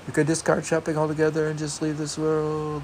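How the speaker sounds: put-on reading voice. Shown as a demonstration. Rereading the shopping line to his partner, mocking high voice. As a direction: rattle it off in a lofty high voice.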